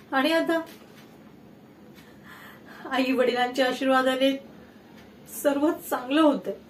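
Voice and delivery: low, soft, halting with long pauses; subdued sorrow. Acted. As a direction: trail through a painful family memory. Grieving over Pooja's illness. (दुःखाने हळू, थांबत थांबत)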